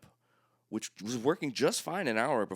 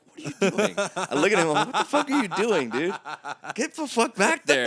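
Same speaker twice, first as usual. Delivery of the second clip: high, loud, laughing disbelief; incredulous yell; 12 semitones higher, 11 dB louder than usual. Retold or imitated. To the drummer at the mic, laughing incredulous yell. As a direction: yell at him through laughter. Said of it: "like I'm about to start, like, yelling at him".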